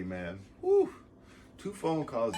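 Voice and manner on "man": ordinary talk, nothing marked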